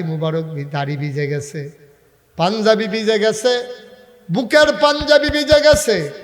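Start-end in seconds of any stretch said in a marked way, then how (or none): 2.4-3.5 raised, louder preaching with a lift; rising preaching. A narrator's reading. Preacher tells of Omar's tear-soaked shirt, rising intensity. 4.3-6.1 very high, loud chanted line; full-force preaching. A narrator's reading. Preacher tells of Omar's soaked shirt, full-force cry.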